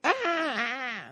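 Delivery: short high fake yelps; mock pained cries. Buddy faking being hit, high mock yelps.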